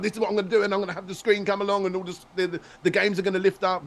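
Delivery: high, excited rambling explanation; rabbiting on. Retold or imitated. Guest re-enacts explaining his idea to people, rabbiting on excitedly. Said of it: "I'm rabbiting on".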